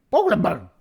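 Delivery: put-on high, loud baby voice. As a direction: squeal the words like a toddler learning them. Baby repeats words.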